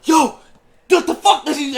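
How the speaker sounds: high, loud panicked "what the fuck"; panicked yell. Retold or imitated. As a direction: yelp it out, trapped and scared. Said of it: "I just started going".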